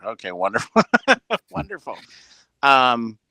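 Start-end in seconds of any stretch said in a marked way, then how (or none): 0.9-1.5 high, short burst of laughter; real laugh, pitched up and louder. Real, not acted. Relieved host told the sound is fine, short high laugh. Laughs at own feedback confusion.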